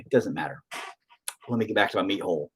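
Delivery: normal talking voice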